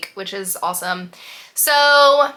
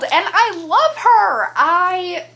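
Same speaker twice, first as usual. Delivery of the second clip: welling up; 8 semitones higher, 3 dB louder, slower than usual. Real, not acted. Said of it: "Why am I about to cry?".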